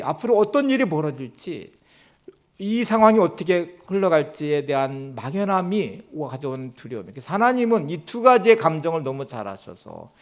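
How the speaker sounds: ordinary talk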